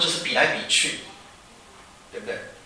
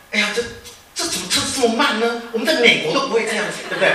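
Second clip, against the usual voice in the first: mock impatient returnee checking watch, higher, half laughing; show-off returnee; 7 semitones higher than usual. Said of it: "你一定会看看表". (假装不耐烦的海归，半笑着抱怨车慢)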